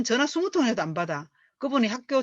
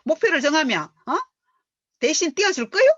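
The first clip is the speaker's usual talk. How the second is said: higher and louder, sarcastic retort; defiant mock challenge; 7 semitones higher, 5 dB louder than usual. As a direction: throw the question back at the leader with mock defiance. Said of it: "그런 반발심이 실실 올라와요". (높고 큰 소리로 비꼬며 따지듯)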